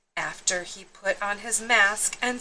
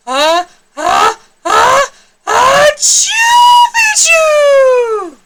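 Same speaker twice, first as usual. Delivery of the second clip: very high, loud, drawn-out comic sneeze; hammed-up sneezing password; big sneeze; 14 dB louder, much slower than usual. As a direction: build up then explode the achoo. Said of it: "half sneezed, half spoke".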